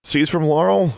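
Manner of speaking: high, loud call into the distance. Acted. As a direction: call out to someone unseen. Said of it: "she called out".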